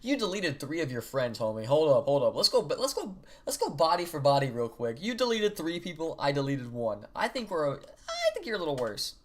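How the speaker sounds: loud, high-pitched arguing back; indignant protest. Real, not acted. Streamer arguing deleted body count with character, indignant. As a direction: protest with exaggerated, playful outrage.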